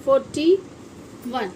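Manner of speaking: ordinary delivery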